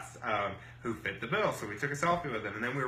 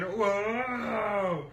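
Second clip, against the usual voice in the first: long, high swooping whoa; imitated crowd amazement; 9 semitones higher than usual. Crowd reacting to another high score, high imitated awe.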